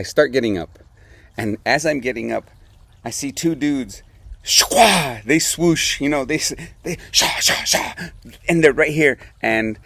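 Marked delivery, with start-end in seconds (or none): 4.4-5.2 swoosh sound. Retold or imitated. Mimics skiers swooshing past. 6.8-7.9 swooshing noise. Retold or imitated. Mimics skiers swooshing past.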